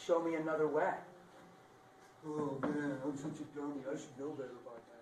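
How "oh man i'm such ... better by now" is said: quiet mock self-reproach; self-scolding believer. Preacher imitating a believer scolding himself, quiet. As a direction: mutter self-blame softly.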